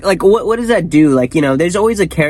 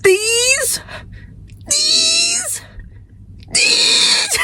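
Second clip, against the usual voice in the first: each these higher and higher; squeaky mounting disbelief; rising repeated question; 4 dB quieter, much slower than usual. Retold or imitated. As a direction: climb up in pitch on every these.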